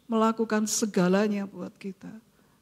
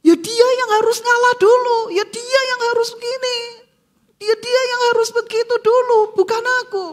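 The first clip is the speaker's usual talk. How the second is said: higher, louder and fast, a whiny stubborn voice; mock selfish complaint; 12 semitones higher, 6 dB louder, faster than usual. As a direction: play the proud ego that won't give in. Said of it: "kita katakan".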